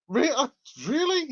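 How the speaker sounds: can't believe what he's reading; incredulous really. Real, not acted. Scoffs at a viewer's theory.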